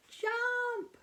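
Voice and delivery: high, loud cry for the frog to jump; urging shout. Pretend. Teacher reading to kids shouts at the frog to jump. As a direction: yell at the frog to get away.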